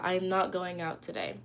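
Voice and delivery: usual speaking voice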